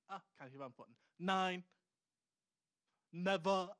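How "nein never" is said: loud, emphatic mock verdict; blunt mock refusal. Host answers a listener's love question, loud mock refusal. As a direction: shut it down hard, jokingly.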